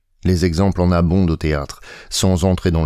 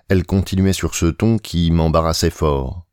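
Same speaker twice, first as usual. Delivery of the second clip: plain reading voice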